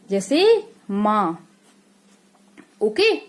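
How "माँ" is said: माँ drawn out slowly, air through nose and mouth; nasal माँ demo. Shown as a demonstration. Teacher showing children a chandrabindu word, slow nasal माँ. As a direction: stretch माँ with a nasal vowel. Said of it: "चंद्र बिंदु लगी होती है".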